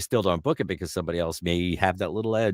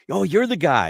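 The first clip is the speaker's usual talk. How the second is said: high, louder burst of recognition; Tom Kenny; 12 semitones higher, 5 dB louder than usual. Retold or imitated. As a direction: imitate a delighted cartoon actor. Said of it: "and he's like".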